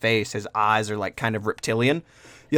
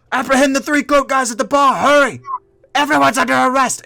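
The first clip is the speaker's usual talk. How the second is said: loud, much higher urgent orders; commanding shout; 13 semitones higher, 6 dB louder than usual. Acted. Barmaid orders knights to arrest everyone, high shout. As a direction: shout over screaming crowd.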